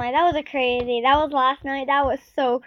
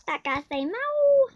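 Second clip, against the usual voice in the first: "Fat cat, say meow" sung much higher; high singsong taunt; 11 semitones higher than usual. Pretend. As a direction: lift it high and playful like teasing a cat.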